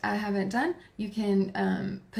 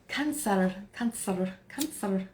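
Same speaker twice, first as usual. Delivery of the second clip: "Cancer" in a playful put-on accent; 3 dB quieter, slower than usual. Streamer announcing the next sign, playful put-on accent. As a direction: say "Cancer" with a mock accent, last syllable altered. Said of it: "I say it with that accent".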